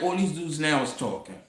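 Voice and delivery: ordinary talk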